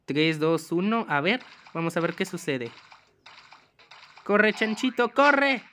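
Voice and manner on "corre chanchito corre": high, loud urging, rising on the last word; urgent cheering on. Pretend. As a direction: yell at the little runner to go. Urges piglet on in the game.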